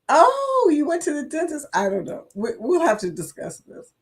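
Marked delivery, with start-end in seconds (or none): 0.1-1.6 high surprised oh; a sudden realising oh, pitched way up. Real, not acted. Realising logo joke.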